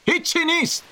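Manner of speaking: high-pitched shout across water; calling back. A narrator's reading. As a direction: call back high to the shore.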